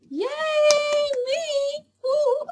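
high squealing little cheer; excited celebrating whoop; self-cheer. Real, not acted. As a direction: cheer for yourself, squeaky and thrilled. Cheering own weight loss.

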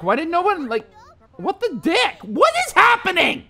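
normal talking voice

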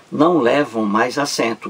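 ordinary talk

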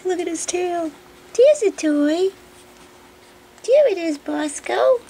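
high, loud, gushing. Real, not acted. Owner excitedly showing the dog its toy, high and loud.

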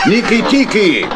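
high call across the boat; calling the lost hedgehog. Acted. Uncle calls out for hedgehog.

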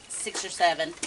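ordinary delivery

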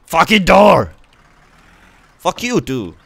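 loud, high angry shout at the door; furious yell. Real, not acted. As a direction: blow up at the stubborn door. Fighting a stuck car door.

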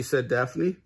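usual speaking voice

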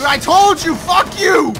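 loud triumphant shout at a friend; excited yelling. Real, not acted. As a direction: yell it out, gloating. Gloating at teammate after kill.

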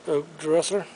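ordinary delivery

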